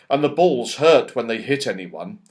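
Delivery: usual speaking voice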